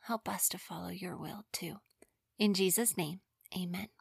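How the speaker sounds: soft unvoiced prayer whisper. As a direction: breathe the prayer in a hush.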